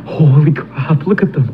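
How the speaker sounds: ordinary talk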